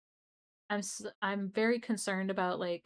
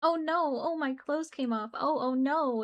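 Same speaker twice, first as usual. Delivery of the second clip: mock anime girl; 6 semitones higher, 4 dB louder than usual. Mocking anime character's fanservice moment.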